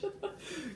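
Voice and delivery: soft, very high giggle. Real, not acted. Vlogger after turning the booklet's page, soft high giggle. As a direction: let the last of the laughter squeak out.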